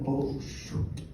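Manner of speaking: whispered, sinking realization; hushed dismay. Retold or imitated. Comedian sees his cousin sobbing after the joke, hushed dismay. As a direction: let it out under your breath, almost voiceless.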